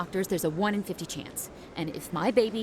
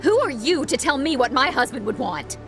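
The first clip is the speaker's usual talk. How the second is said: louder, higher angry challenge; indignant; 4 semitones higher, 5 dB louder than usual. Acted. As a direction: throw the question back in outrage. Widow quarrels with brother-in-law.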